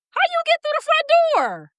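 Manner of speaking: high squeaky startled voice, way up top; jolted awake, squeak it out in alarm; startled squeal. Retold or imitated. Woken by the devil, high startled squeal. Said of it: "I woke up in my sleep".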